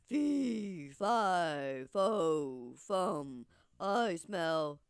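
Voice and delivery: low, loud giant bellowing his chant; booming giant. Acted. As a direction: menace the whole kingdom, slow and heavy. Narrator voices the giant again.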